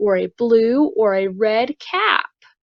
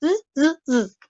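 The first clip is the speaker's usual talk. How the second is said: monkey chatter; 7 semitones higher, faster than usual. Acted.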